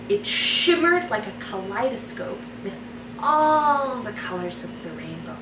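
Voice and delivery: soft, unhurried fairy-tale reading with long pauses; story narration. Acted. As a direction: read it like a bedtime story. Reads her fairy tale aloud.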